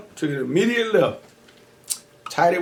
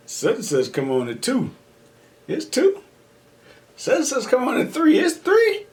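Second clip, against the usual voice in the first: rising despair; 9 semitones higher than usual. Retold or imitated. Watching the clock in despair.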